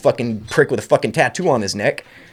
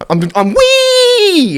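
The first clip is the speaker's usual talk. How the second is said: a drawn-out, much higher squeal of "wee"; mock roller-coaster whee; 5 dB louder, much slower than usual. Joking he's on a roller coaster, slow high whee. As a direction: ride the word up like a coaster drop.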